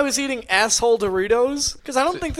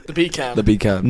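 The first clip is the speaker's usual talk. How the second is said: British accent; 8 semitones lower, much slower than usual. Shown as a demonstration. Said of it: "when you say beer can in a British accent".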